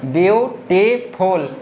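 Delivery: Beautiful slowly split as view, टी, फुल; a teacher's slow three-beat beautiful; syllable by syllable. Shown as a demonstration. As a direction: stop twice inside beautiful, after view and after टी. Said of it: "कितना जगह stoppage किए हम".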